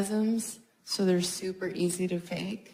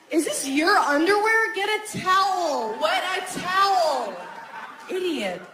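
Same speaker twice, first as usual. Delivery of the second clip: annoyed wife voice; 5 semitones higher than usual. Retold or imitated. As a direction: nag him, exasperated. Acting out wife's annoyed reaction.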